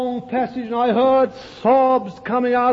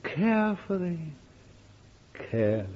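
soft, falling phrase; 3 semitones lower, 8 dB quieter than usual. Shown as a demonstration. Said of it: "but to live".

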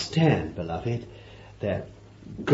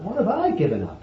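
mock boast; 6 semitones higher, 4 dB quieter, faster than usual. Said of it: "he could have said for you".

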